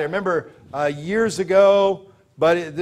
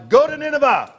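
God's command; 7 semitones higher, 3 dB louder than usual. Acted. God commanding Jonah.

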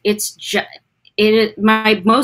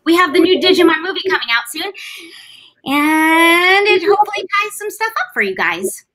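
put-on higher young girl voice; Kari voice; 6 semitones higher than usual. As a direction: talk like a sweet young girl. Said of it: "I'm still talking like her".

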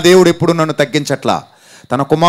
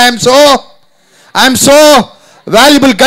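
higher, louder affirmation for the crowd to repeat; loud call-and-response; 7 semitones higher, 5 dB louder, much slower than usual. Shown as a demonstration. Preacher leading the congregation, calls out loudly. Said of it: "చెప్పండి".

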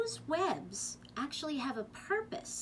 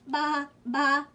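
loud, raised two-beat bleat; sheep bleating; 4 semitones higher, 8 dB louder than usual. A narrator's reading. Reader voicing the sheep for children, bleating. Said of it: "bleated the sheep".

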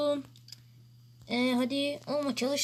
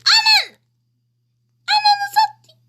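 very high, loud scream; 10 dB louder than usual. Real, not acted. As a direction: scream the curse while falling. Jumping off the building.

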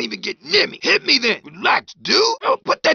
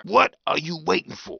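ordinary talk

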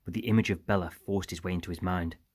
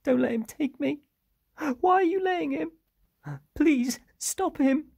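put-on very high, louder pleading child; crying out in fear; 5 dB louder than usual. A narrator's reading. Girl being dragged away begs for help, crying out. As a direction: beg desperately in a high little-girl voice, slowing on the last plea. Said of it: "cried Bella".

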